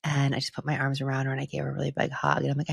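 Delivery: ordinary delivery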